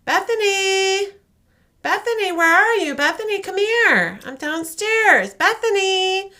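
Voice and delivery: high, loud sing-song calling of a name; calling mother. Retold or imitated. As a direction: call out through the house like a mom. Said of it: "hearing her mother say".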